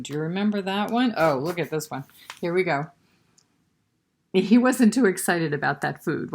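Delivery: ordinary delivery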